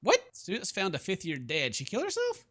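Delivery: ordinary talk, nothing marked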